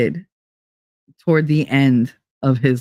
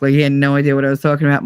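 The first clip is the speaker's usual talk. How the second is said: ordinary talk, nothing marked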